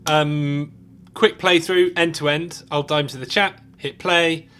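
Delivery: triumphant rush to the finish line; excited. Real, not acted. Finishing the track, thrilled.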